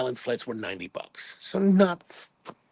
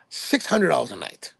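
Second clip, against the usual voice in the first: ordinary delivery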